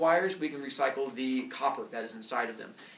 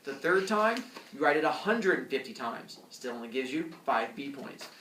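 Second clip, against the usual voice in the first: ordinary delivery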